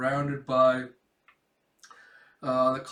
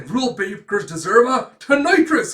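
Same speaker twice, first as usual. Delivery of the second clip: loud, high battle cry; acted disciplinarian outburst; stern punisher; 8 semitones higher, 11 dB louder than usual. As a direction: bellow the sentence on a rule breaker. Schlag passes punishment.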